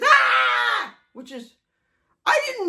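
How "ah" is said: sudden high yelp of pain; a loud startled cry as she falls; reenacted scream. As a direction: let out a short shocked yelp. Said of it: "all my husband hears is".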